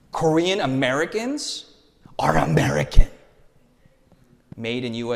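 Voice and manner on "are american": breathy whispered aside, no voice at all; stage whisper. Acted. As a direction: whisper it like a proud secret. Proud Korean American punchline.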